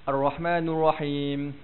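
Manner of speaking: "Ar-Rahmanur Rahim" with an "u" in "nur", slow; wrong vowel. Shown as a demonstration. Teacher shows a reading to avoid, "nur" in "Ar-Rahmanur". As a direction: recite "Ar-Rahmanur Rahim" rounding "ni" into "nu". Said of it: "jangan kita bunyi macam ni".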